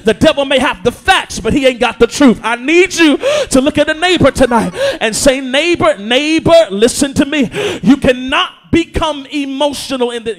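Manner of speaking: full-force Sunday preaching. Acted. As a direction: push the words out over the crowd.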